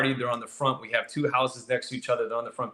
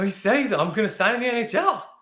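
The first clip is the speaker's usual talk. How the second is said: excited news; 10 semitones higher than usual. Retold or imitated. Telling buddies the big news.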